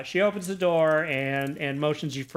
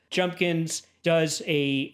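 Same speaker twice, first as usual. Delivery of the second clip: ordinary talk, nothing marked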